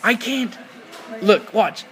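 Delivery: high, insistent complaint; worked-up, high-pitched frustration; acted exasperation. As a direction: plead your case, pitched right up. Acting out frustration at captive.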